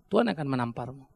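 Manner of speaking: ordinary talk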